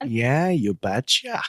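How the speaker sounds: slow, drawn-out North Dakota "Yeah, you betcha"; playful North Dakota accent mimic. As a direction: tease her with a Midwestern North Dakota twang. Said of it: "I have a North Dakota accent".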